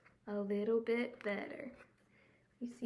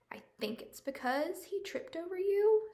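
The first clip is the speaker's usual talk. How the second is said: whisper; 7 semitones higher than usual. Acted. Norman character line.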